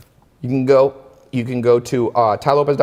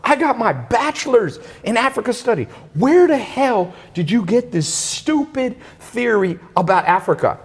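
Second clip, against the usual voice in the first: snooty Harvard friend; 10 semitones higher than usual. Retold or imitated. Mocking the friend boasting about her Harvard degree. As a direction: play up her outrage in a much higher voice. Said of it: "She goes".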